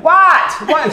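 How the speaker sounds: sudden loud high "What?"; retold yell. Loud girl woken at night, yelling confused. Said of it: "She's just yelling".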